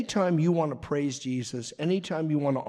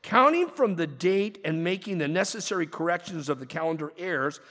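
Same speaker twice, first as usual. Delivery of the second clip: ordinary talk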